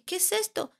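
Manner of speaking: a mother taken aback by a drawing; retold surprise. Mother asking her daughter about a strange drawing, surprised. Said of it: "me saqué mucho de onda y le dije".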